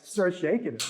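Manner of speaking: ordinary delivery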